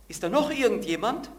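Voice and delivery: loud, high call for someone else; desperate shout. Acted. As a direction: yell the punchline upward. Punchline shouted from cliff.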